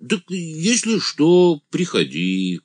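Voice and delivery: высокий протяжный зачин простака; тяни начало высоко, по-простецки; блаженный «ангел», советует. A narrator's reading. (юродивый, радушно)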